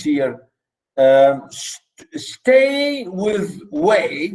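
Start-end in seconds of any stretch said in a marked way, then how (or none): 2.2-4.3 "stay" and "way" drawn out very slowly, pitched up; slow, deliberate pairing of "stay" with "way"; stressing the rhymes. Shown as a demonstration. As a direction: stretch the shared vowel in "stay with way".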